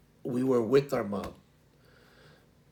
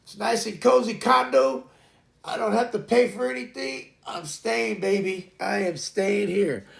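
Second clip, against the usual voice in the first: higher, louder mock voice of a stubborn baby; baby refusing to come out; 8 semitones higher, 4 dB louder than usual. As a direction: play a cosy unborn baby who won't budge. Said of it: "Atlas was like".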